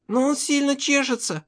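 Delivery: put-on high child voice, complaining; маленькая дочь. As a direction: whine to daddy in a little girl's high voice. Дочь жалуется папе на зуд. (жалобный высокий детский голосок)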